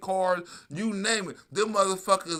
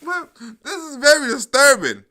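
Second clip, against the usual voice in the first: mock alarm; 6 semitones higher, 7 dB louder than usual. Mocks news gravity.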